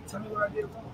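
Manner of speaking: breathy whisper, high and hushed; whispered plea. Real, not acted. Preacher whispering God's plea to the congregation. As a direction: beg it softly. Pleading aside, voice drops.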